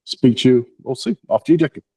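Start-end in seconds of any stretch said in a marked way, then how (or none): none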